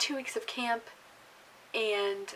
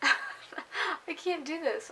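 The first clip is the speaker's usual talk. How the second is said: laughing; 6 semitones higher, much slower than usual. Real, not acted. Laughing while eating fondant.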